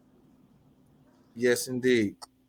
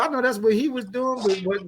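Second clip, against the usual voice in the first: ordinary delivery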